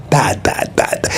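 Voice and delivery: normal talking voice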